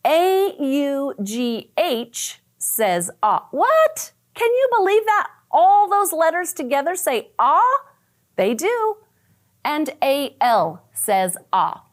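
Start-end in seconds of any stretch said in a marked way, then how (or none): 3.5-9.0 high, playful mock disbelief; mock amazement. Teacher feigning shock at A U G H spelling. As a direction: act amazed for the kids. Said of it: "mock amazement at spelling".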